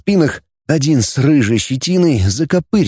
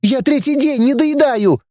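very high, thin little voice; high-pitched indignant woman; thin-voiced lady; 12 semitones higher than usual. A narrator's reading. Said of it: "тонким голосом отозвалась".

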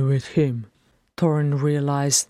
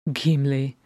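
usual speaking voice

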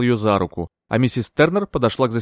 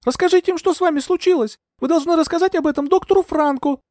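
very high, imploring cry; high, urgent entreaty; pleading; 3 dB louder than usual. A narrator's reading. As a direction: implore her desperately. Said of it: "взмолилась я".

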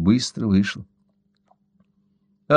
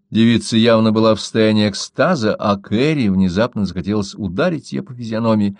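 plain reading voice

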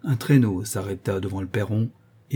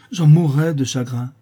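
higher, louder, impassioned; fervent conviction; 5 semitones higher, 8 dB louder than usual. A narrator's reading. Girl answering the prince about leaving her cousin, with fervent conviction. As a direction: declare it with total conviction. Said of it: "répondit la jeune fille avec la même conviction".

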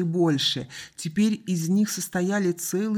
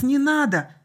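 high, loud plea; boy's desperate outcry; crying out; 9 semitones higher, 6 dB louder than usual. A narrator's reading. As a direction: shout it in panic. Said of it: "выкрикнул Артур".

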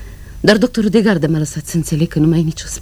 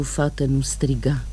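ordinary talk

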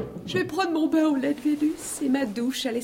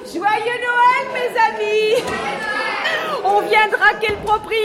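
higher and louder, crowing; gleeful mockery; 9 semitones higher, 3 dB louder than usual. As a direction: sing out the taunt. Gleeful mock Christmas greeting.

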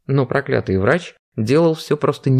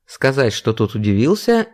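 ordinary delivery, nothing marked

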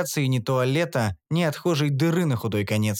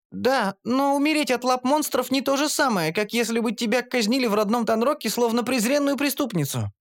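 high, aggrieved gnome voice; gnome girl; 10 semitones higher than usual. A narrator's reading. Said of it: "гномка". (гномка возражает высоким голосом)

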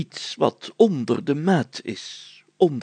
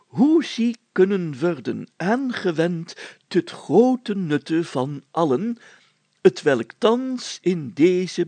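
put-on slow, higher, ceremonious recital of an old deed; solemn archaic reading; 5 semitones higher, slower than usual. Mayor reading an old parchment aloud to his friends, slow and solemn. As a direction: read it like a proclamation from centuries past.

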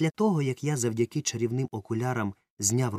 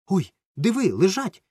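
much higher and louder gasp of wonder; amazed; 9 semitones higher, 7 dB louder than usual. Acted. Romka spotting the glasses on the bench, loud and amazed. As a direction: blurt it out, stunned to see them. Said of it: "вражено мовив Ромка".

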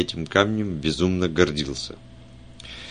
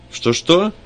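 surprised; 11 semitones higher, 6 dB louder than usual. A narrator's reading. Startled reply on the phone.

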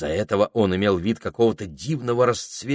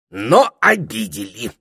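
loud, affronted parrot retort; curt retort; 3 semitones higher, 8 dB louder, slower than usual. A narrator's reading. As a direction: cut them off, offended. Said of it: "отрубил попугай".

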